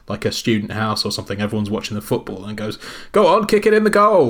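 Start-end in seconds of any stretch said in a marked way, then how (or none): 3.1-4.3 loud, high clueless dad cheering. Retold or imitated. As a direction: shout like a dad who knows nothing of football. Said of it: "someone's dad who has no idea about football".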